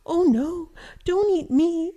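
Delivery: high, loud storybook plea; pleading little goat. A narrator's reading. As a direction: squeal it out as the smallest goat. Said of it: "said Little Billy Goat Gruff".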